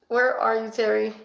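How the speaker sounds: ordinary talk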